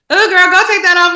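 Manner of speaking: loud, high mock shout across the room. Petty girl shouting across the room to embarrass a friend, a mock yell. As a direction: yell it to embarrass her in front of everyone. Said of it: "I'm shouting like".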